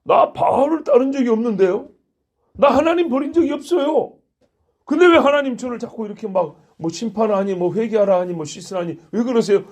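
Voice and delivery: high, loud whiny protest of innocence; indignant protest. Acted. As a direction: play the affronted people talking back to God. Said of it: "막 이렇게 항변한다는 겁니다".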